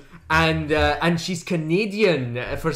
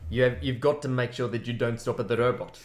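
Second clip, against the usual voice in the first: South African accent; 5 semitones lower, 6 dB quieter than usual. Shown as a demonstration. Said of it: "when you're in South Africa".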